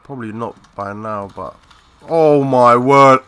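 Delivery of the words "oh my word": slow and loud oh my word; amazed gamer reacting to chaos; stunned. Real, not acted. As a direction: draw it out in disbelief. Stunned by the game.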